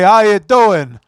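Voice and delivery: loud, high mock Sopranos greeting. Friend showing merch girl the greeting, loud Sopranos accent. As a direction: belt it like a caricatured Sopranos mobster. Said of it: "teach her how to speak Sopranos".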